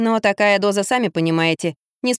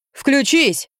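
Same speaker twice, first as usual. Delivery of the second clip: higher, sharp acted order; drunken command; 6 semitones higher than usual. A narrator's reading. Drunk man orders Lampa to switch on, commanding. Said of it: "велел Юрий".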